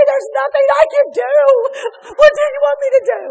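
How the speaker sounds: the helpless husband shouting down the phone; frantic husband. Retold or imitated. Husband yells at his crying wife that he is too far away to help. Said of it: "he was like".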